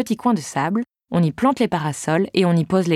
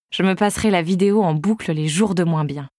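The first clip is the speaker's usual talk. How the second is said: ordinary delivery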